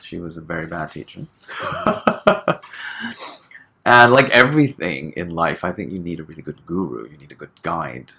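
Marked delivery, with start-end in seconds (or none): 1.5-3.6 high breathy laugh. Real, not acted. Laughs about bad teacher.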